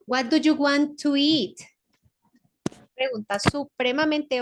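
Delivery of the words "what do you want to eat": "What do you want to eat?" said slowly and a bit louder; slow, clear model of the English question. Shown as a demonstration. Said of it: "¿Qué quieres comer?".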